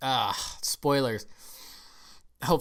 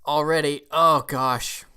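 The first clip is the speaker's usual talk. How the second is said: overwhelmed, louder outburst; getting emotional; 3 semitones higher, 8 dB louder, slower than usual. Real, not acted. Reviewer overcome by the film's ending, emotional. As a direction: let the emotion spill out loudly. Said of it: "I'm starting to get all emotional".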